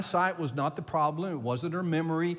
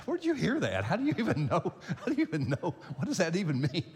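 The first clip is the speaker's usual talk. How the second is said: higher, incredulous rapid-fire questions; amused disbelief; 4 semitones higher, faster than usual. Retold or imitated. Grandfather baffled that his little grandchild calls him Pastor, amused disbelief. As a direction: ask in mock astonishment, half laughing.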